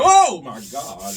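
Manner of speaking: slow, drawn-out, loud amazed gasp; mock amazement. Sanding by eye, then stunned when the paint shows the hump, drawn out. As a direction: drag it out like you just saw the flaw. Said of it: "you be like".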